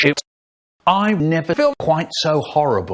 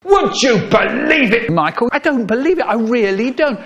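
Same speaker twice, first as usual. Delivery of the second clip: scolding mum; 6 semitones higher than usual. Acted. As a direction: scold him like an outraged mum.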